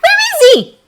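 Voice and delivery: very high, strained loud question; whisper-shout. A narrator's reading. Uraraka asks about the missing friend, whisper-shouting. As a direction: demand an answer urgently. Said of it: "Uraraka whisper shouts back".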